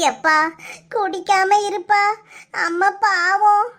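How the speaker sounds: tearful plea; crying. Acted. Departing bride begs father to look after mother, crying. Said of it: "அழாத டி".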